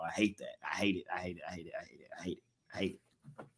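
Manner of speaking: muttered refrain, dying away; fading grumble. Real, not acted.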